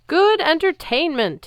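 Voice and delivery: bellowing giant. Acted. Giant addressing the arena crowd, loud slow bellow. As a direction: boom it over the crowd. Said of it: "he bellowed".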